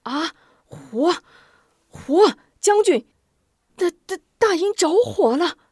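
high, loud stammering panic; panicked alarm. Acted. An officer sees the camp burning and cries to the general, stammering panic. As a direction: stutter out the alarm in terror. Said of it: "顿时慌张".

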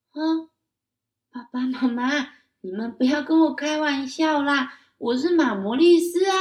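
put-on high, loud child voice, upset; pleading child. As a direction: beg them to stop joking. (高声童音，着急地恳求)

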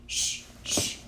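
"Sh-, sh-," as a voiceless hush; sounding out the letter. Shown as a demonstration. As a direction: breathe out "Sh-, sh-," with no voice. Teacher sounds out first letter.

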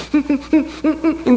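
high, closed-mouth whimpers; pulsed grunting whimper; stifled crying. Shown as a demonstration. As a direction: imitate sobs that won't come out. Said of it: "那种哭都不算数".

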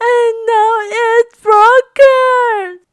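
a small bear cub, high and loud, slow and plaintive; wailing Baby Bear. Acted. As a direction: whine it like a tiny upset cub.

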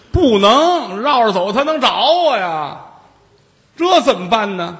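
high, loud, pleading Taoist voice; frantic Taoist. Acted. Taoist begging Jigong for help against the demon, high frantic pleading.

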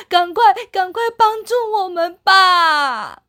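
high teary little bear pleading; crying. Acted. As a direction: sob out a plea to stop fighting. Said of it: "北极熊弟弟也哭着说". (北极熊弟弟带着哭腔恳求超人)

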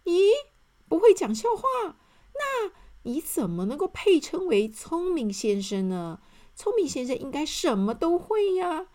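high, loud incredulous challenge; a disappointed friend doubting the clever man; doubtful. Acted. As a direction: question him in disbelief. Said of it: "怀疑地说".

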